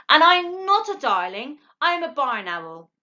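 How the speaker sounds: high, huffy little owl; indignant owlet. A narrator's reading. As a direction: set her straight.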